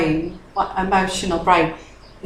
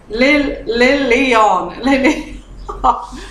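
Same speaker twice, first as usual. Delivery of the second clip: giggling; 3 semitones higher, much slower than usual. Real, not acted. Tipsy giggly name repeat.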